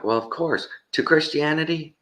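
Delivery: high-pitched imitation of a street exchange; mock street interview. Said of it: "these people are like".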